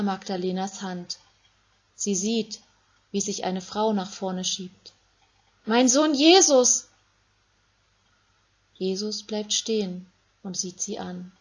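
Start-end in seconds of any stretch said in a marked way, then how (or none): 5.7-6.9 loud, higher cry of a mother; a mother crying out to her condemned son; anguished cry. Acted. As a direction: call out to him in anguish.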